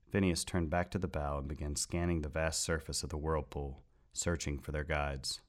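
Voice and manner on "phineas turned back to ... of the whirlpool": ordinary delivery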